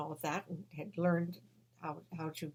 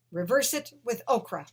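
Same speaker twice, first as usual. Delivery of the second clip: higher and louder, firm command; vision commanding; 5 semitones higher, 6 dB louder than usual. Retold or imitated. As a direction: order it like a voice from beyond. Vision gives instruction.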